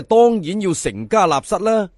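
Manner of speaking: ordinary delivery, nothing marked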